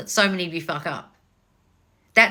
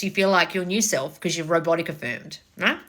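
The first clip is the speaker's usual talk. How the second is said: usual speaking voice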